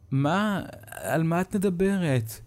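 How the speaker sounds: barely awake and confused; just waking up. Acted. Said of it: "אני עוד מנסה להתעורר". (מבולבל ורדום, לאט)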